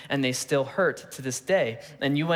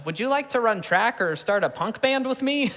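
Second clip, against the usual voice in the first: much higher, mock nerdy little kid voice; awkward kid; 7 semitones higher than usual. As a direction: timidly ask like the scrawny kid in the corner. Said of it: "like, you know".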